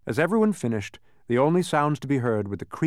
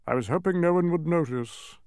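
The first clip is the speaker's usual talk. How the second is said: higher, mildly crestfallen king; a touch of woe; sad; 8 semitones higher than usual. A narrator's reading. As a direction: admit it sadly. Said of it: "said the king sadly".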